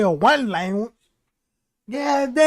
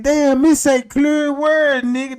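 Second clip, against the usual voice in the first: drawn out and loud, broken English; slow loud mock broken English; 5 semitones higher, 5 dB louder, slower than usual. Frustrated with the neighbor, slow loud broken English. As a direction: spell it out slowly like he's hard of hearing. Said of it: "I'm like".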